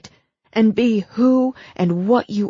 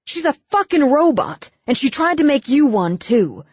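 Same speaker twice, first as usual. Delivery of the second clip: louder, heated tirade rising high; building rant; 6 semitones higher, 4 dB louder than usual. Acted. Brooke railing at Abigail's cold mother, heated and loud. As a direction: tear into the mother, voice climbing.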